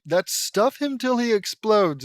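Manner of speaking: big mock-heroic outburst; hammed-up battle cry. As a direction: throw yourself into the hero's catchphrase. Acted battle cry, restarted louder.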